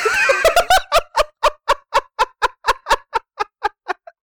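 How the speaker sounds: long high screaming laugh; shrieking laugh. Real, not acted. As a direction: howl with laughter, loud and high. Cracking up at own joke.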